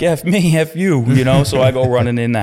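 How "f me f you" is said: retold yell, pitched up high; mock high shout back. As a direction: snap it right back at the kid. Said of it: "And I'm like".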